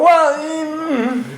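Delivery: high-pitched, drawn-out evasive hum; acted sheepish hedging. Caught out by sponsor's question.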